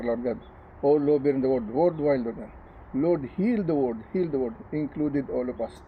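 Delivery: earnest spoken prayer, pleading and repeating Lord God; fervent prayer. Acted. Pastor leading an opening prayer for viewers, fervent pleading. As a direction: pray it aloud, pleading with God for the flock. Pastor prays aloud before sermon.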